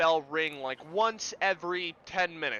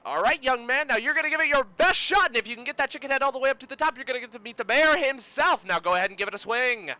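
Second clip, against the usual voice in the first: loud, high, fast-talking carny patter; carnival barker; 6 semitones higher, 5 dB louder, faster than usual. Acted. Carny sending a young man up to swing, hearty barker's pitch. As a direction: hype up the next player like a showman. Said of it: "the carny looks over".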